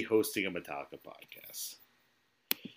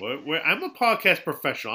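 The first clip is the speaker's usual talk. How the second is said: mock pompous; 6 semitones higher, 6 dB louder than usual. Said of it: "how much of a professional I am".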